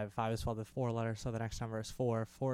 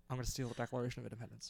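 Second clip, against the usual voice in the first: Nick Cage; 9 dB quieter, much faster than usual. Retold or imitated. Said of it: "Is that your Nick Cage impression? 'Cause it's not great".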